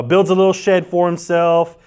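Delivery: ordinary talk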